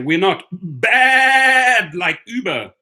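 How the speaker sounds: long, loud, high bleat on "bad"; goat-bleat pun. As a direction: stretch "bad" into a goat's baa. Repeats the goat bleat slogan.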